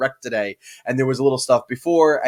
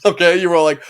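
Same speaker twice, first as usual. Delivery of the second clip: usual speaking voice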